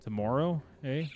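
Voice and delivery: put-on Canadian O in "Tomorrow", then "eh"; Canadian accent. Shown as a demonstration. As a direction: lean on the Canadian O, questioning. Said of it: "say my O's a little bit weirder".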